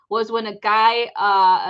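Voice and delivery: ordinary talk, nothing marked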